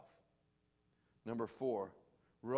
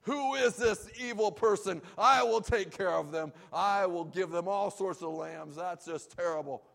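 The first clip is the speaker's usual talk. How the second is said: loud, high outraged king; hammed-up fury at the injustice; David loudly demanding who did it; righteous indignation; 8 semitones higher, 11 dB louder than usual. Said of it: "David gets all righteously indignant and upset".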